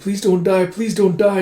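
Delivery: high, urgent begging; pleading. Acted. Princess begging wounded Huck to live, pleading. Acted plea over injured Huck.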